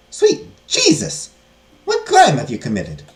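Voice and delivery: loud, drawn-out exclamation; scandalized abbess outburst; shocked nun. Acted. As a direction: react aghast, starting very high and slow.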